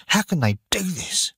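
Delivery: high, upset cry of protest; angry. A narrator's reading. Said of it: "said Maggie angrily".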